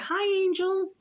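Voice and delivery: imitating a high, drawn-out sing-song hello; high-pitched greeting. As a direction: mimic his friendly greeting way up high. Acts out homeless man's greeting.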